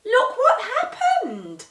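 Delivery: high, slow, laughing wonder; delighted laugh. A narrator's reading. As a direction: laugh with joy at the surprise. Molly delighted at the pots.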